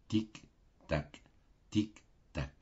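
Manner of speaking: soft ticking clock sounds. A narrator's reading. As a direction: tap it out softly like a clock. (tic-tac doux d'horloge)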